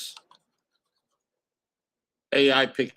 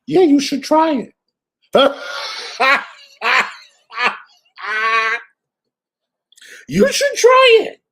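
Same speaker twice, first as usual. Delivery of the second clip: higher, much louder, laughing through the words; laughing sarcastic dare; 13 semitones higher, 9 dB louder than usual. Real, not acted. Daring the other streamer to try it, loud and laughing.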